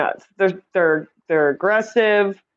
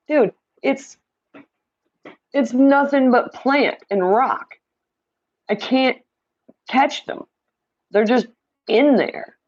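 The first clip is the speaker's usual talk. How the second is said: higher, slower, fed-up pleading; exasperated retort; 6 semitones higher than usual. Retold or imitated. Telling a friend she can't catch the fish, higher and fed up. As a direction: lay out the hopeless tank, drawn out and exasperated. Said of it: "And I'm like".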